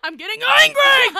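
high, loud laughing mock yell; mock anger. Playing the hormonal friend, loud laughing mock yell. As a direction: shout it like a cartoon tantrum.

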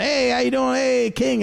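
jokey, needling hello; playful greeting. Retold or imitated. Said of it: "I'm trying to have fun with him".

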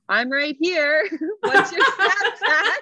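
high, loud laughing quote. Retold or imitated. Host quoting a DM from a guy, laughing hard. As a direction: crack up while quoting him. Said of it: "one guy's like".

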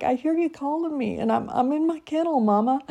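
high, fast pleading puppy voice; voicing her dog. Voicing her dog stuck in his kennel, high squeaky plea. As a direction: squeak it like a little dog begging. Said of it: "He's like".

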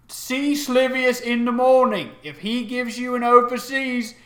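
stern ship captain. Acted. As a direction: lay down the rules as captain. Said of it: "she looks and she says".

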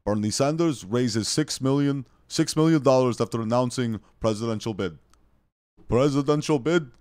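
hammed-up loud headline read, repeated for effect; put-on headline voice.

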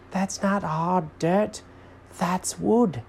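slow, high put-on voice; correcting Jenkins character. A narrator's reading.

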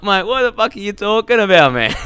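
high, loud mock disbelief; baffled outburst. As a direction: shout back in exasperated confusion. Acted baffled reply to the rant.

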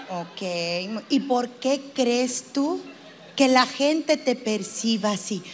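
slow, measured therapist question; probing psychologist. Acted. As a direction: draw the question out with studied patience.

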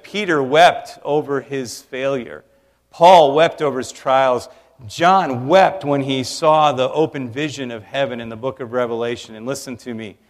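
normal talking voice

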